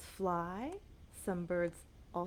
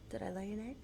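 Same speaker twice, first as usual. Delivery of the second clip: ordinary talk, nothing marked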